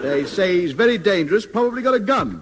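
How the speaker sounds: high, lively and emphatic; excited warning. Acted. Man warning a stranger about the convict, excited and high. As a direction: climb in pitch as the danger grows.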